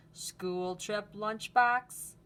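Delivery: lively storybook read-aloud; reading to children. Acted. Teacher reading Luna's story to the class, lively. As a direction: read it brightly for a class of kids. Reads picture book aloud.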